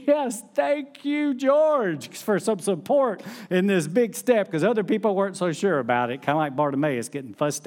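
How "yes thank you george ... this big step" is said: high, slow, thankful inner cry; inner relief. Retold or imitated. Pastor silently thanking George for his support, high and grateful. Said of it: "And inside me, I'm just".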